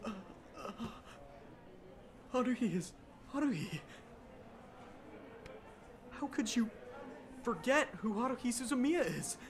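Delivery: stunned. Acted. Stunned disbelief, voice faltering.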